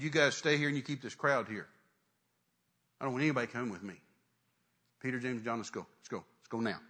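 low, controlled orders; stern. Acted. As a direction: give orders that allow no argument. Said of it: "he's gotten real stern".

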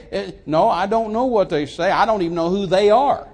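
loud, emphatic preacher's refusal; indignant rebuttal. Acted. Preacher answering those who quote what they say, indignant. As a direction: throw the hearsay back forcefully. Preacher rebuffs the gossips he mimicked.

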